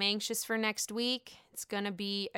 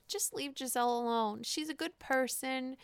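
normal talking voice

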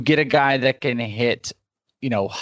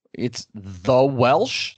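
slow, confused "the Welsh?" with a rising end; puzzled question; much slower than usual. Retold or imitated. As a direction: imitate someone baffled by the name. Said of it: "people would be like".

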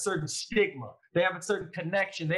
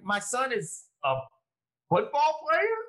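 disbelief; 7 semitones higher, slower than usual. Retold or imitated. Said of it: "my mom is in disbelief".

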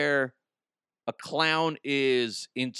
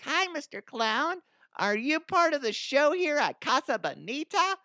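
put-on high, sweet little boy voice; little kid voice; 14 semitones higher than usual. Little Timmy greeting the clown, high sweet child voice. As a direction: sound like a small kid asking a clown. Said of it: "little Timmy comes up and be like".

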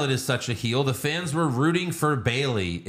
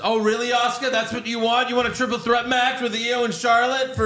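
high, put-upon challenge; Bayley; 10 semitones higher than usual. Retold or imitated. Wrestler pretending to understand her rival, high mock-indignant voice. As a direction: pitch it up like a wrestler's promo. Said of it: "And Bayley says".

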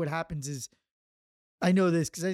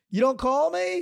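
higher, louder hurt accusation; mock offended friend; 8 semitones higher, 5 dB louder than usual. Richard complaining he wasn't called, mock hurt. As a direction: play the wounded friend. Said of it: "Richard, who was like".

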